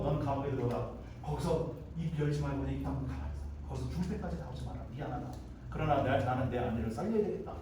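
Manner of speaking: low, soft resigned command; subdued, apologetic order. Acted. Master sending Joseph to prison to save his wife, subdued apology. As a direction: keep it hushed and reluctant. 보디발이 미안해하며 투옥 명령.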